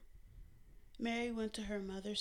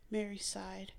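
ordinary delivery, nothing marked

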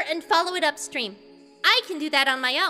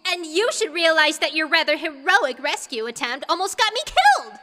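high-pitched, indignant retort rising louder; furious young princess; angry reply; 8 semitones higher, 3 dB louder than usual. A narrator's reading. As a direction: throw the blame back angrily, climbing to a peak. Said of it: "Ruto replied angrily".

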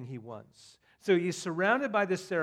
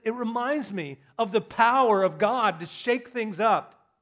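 preaching swell; 6 semitones higher, 10 dB louder than usual. Real, not acted.

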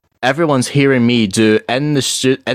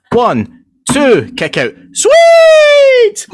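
loud rising crowd heckle; crowd shout; 7 dB louder, much slower than usual. Retold or imitated. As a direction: yell it from the stands. Heckling at wrestling show.

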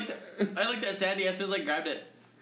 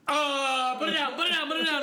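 louder, high, urgent retold alarm; raised-voice pretend fright; mock panic; 7 semitones higher, 4 dB louder than usual. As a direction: act out a nervous scramble. Acts out alarm holding a taser.

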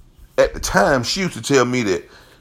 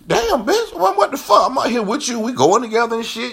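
jealous outrage; 12 semitones higher than usual. Retold or imitated. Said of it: "I'm up here really thinking".

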